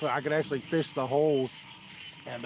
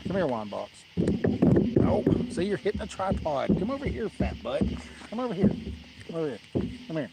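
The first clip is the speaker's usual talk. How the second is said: calling the dog; 5 semitones higher, 6 dB louder than usual. Real, not acted. Calling dog over, raised voice.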